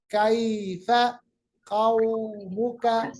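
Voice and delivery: कौमुका with a slow, soft au; soft drawn vowel. Shown as a demonstration. Teacher models कौमुका softly for students. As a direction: draw out कौ gently. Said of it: "कौ आवाज को मुलायम आवाज बनाइएगा".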